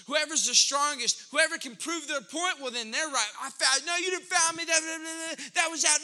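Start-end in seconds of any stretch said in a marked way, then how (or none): 3.4-6.0 mock street-ball squabble, raised pitch; higher-pitched acted bickering; arguing. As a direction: argue a foul call over each other. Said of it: "when you're arguing".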